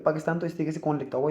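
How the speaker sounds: ordinary delivery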